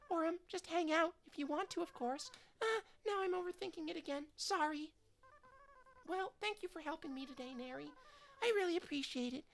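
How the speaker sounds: flustered schoolgirl chattering too fast for her own good; shy eager girl. Acted. Voices shy girl Chrissy.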